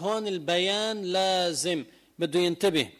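usual speaking voice

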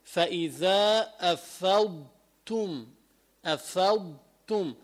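فإذا أفضتم, syllable by syllable, with the ض clear; slow, spelled out. Shown as a demonstration. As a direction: take أفضتم slowly and distinctly. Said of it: "هجية على مهلك". (على مهلك بوضوح الضاد)

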